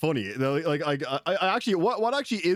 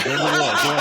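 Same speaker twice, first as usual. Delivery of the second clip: louder taunt breaking into laughter; laughing taunt; 7 dB louder than usual. Retold or imitated. Gamer taunting a British soldier, loud and laughing. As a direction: laugh while goading him. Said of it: "I'm like".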